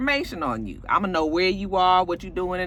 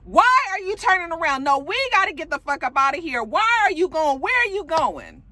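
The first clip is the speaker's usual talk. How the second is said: high-pitched mock girlfriend fussing; high, insistent retold outburst; agitated protest; 8 semitones higher than usual. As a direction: imitate an exasperated partner demanding answers. Acts girlfriend protesting the turnaround.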